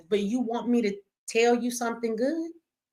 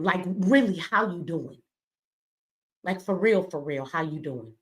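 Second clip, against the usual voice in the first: softer, lower and heartfelt; sincere asking; 5 semitones lower, 3 dB quieter than usual. Shown as a demonstration. Showing parents how to ask a jailed child, sincere and soft. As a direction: ask it like you truly mean it. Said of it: "you should ask them how they doing".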